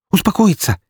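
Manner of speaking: high-pitched, indignant echo; agitated woman's exclamation; outraged. A narrator's reading. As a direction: throw the word back in outrage. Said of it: "Её аж трясло от злости".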